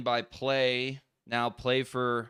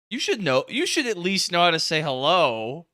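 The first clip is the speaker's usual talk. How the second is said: mock scolding; 6 semitones higher, 6 dB louder than usual. Real, not acted. Mock scolding his guest.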